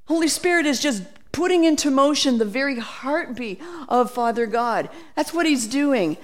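ordinary talk, nothing marked

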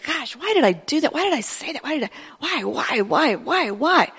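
high, soft, baffled pleading whys; exasperated self-questioning. Retold or imitated. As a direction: ask yourself why, quietly exasperated. Preacher acts out frustrated self-talk.

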